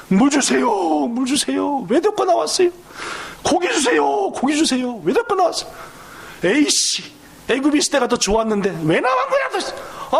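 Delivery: high, whining demands for water and meat; whiny complaining crowd. Acted. Pastor acting out the Israelites nagging Moses, whiny and high. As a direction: pester like ungrateful grumblers.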